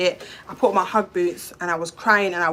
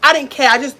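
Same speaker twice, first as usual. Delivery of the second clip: anguished outburst; 5 semitones higher, 6 dB louder than usual. Real, not acted. Emotional outburst about despair.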